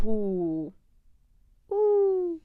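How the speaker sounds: a high, swooning "ooh". Real, not acted. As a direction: gush over a crush. Swooning over the British guy.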